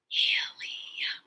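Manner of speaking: HELIUM whispered in three drawn-out beats; whispered syllables. Shown as a demonstration. Teacher leading children through word parts, Helium whispered. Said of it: "Whisper with me".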